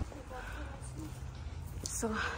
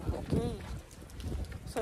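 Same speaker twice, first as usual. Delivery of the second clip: ordinary talk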